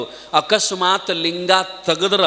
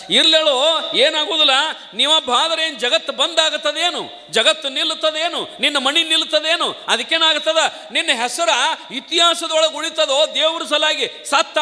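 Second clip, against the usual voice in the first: raised, brushing-off guru telling the disciple to die; lightly voiced smug guru, much higher; mock guru voice; 11 semitones higher than usual. Guru reassures disciple dismissively. (ಗುರುವಿನ ತಾತ್ಸಾರದ ಎತ್ತರದ ಧ್ವನಿ)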